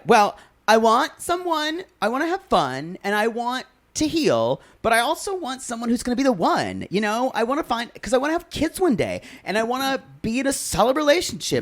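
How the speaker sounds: high, increasingly quick impression of her; Tanya. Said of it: "She's like".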